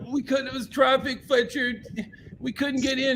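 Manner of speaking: squeaky mock pleading; retold pleading excuse. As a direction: imitate helpless excuses to someone furious. Band makes excuses to Fletcher.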